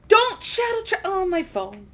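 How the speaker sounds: high, fumbling yelp over a dropped phone; flustered Eric. Acted. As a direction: scramble after the phone, caught off guard. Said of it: "as you hear Eric go".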